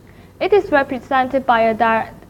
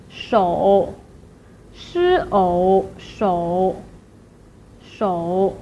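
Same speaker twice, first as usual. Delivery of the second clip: "shou" dipped low, then curving up slightly, split as "shi ou"; third tone; 5 semitones lower, much slower than usual. Shown as a demonstration. Teacher drills the word for hand, low dipping "shou". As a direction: sink low on "shou" and bounce off the bottom. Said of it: "Try to go as low as you can".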